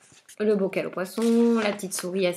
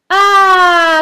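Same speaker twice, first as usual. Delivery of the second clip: very loud, high furious howl; witch's howl; 10 semitones higher, 15 dB louder than usual. Acted. Witch howling in rage, very loud and high. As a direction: let out a shrieking howl. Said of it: "la sorcière se met à hurler".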